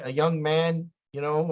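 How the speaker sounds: usual speaking voice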